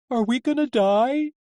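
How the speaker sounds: very high little scared voice; small high-pitched frightened question; whimpering. A narrator's reading. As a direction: whimper it high, rising at the end. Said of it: "Rambo whimpered".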